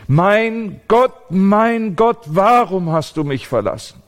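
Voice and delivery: loud, high desperate outcry; piercing shout of abandonment; anguished cry. Acted. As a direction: cry out to God in agony. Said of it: "ein Wort für rufen und schreien".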